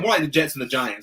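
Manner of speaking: usual speaking voice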